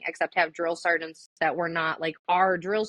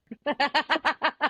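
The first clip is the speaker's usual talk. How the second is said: laughing; 9 semitones higher than usual. Real, not acted. Laughs after story ends.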